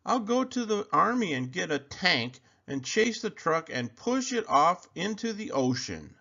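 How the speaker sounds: high bully voice threatening; bully threat. A narrator's reading. Bully Sammy threatening Kevin with a tank. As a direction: top his every idea.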